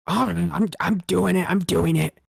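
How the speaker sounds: high, loud defensive goblin whine; flustered goblin. Acted. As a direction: protest that you're on it, flustered. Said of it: "two voices bickering with each other".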